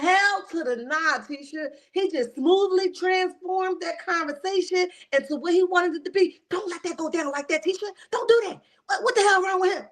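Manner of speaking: high, exasperated mock outrage; yelling at TV. Viewer warning Tisha about his smooth talk, exasperated yelling. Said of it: "I'll be the one yelling at the T V".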